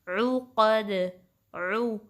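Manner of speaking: عقد starting with squeezed throat u; mid-throat ain. Shown as a demonstration. Teacher demonstrating ain, throaty عقد and عو. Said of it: "وسط الحلق سے نکلے گا".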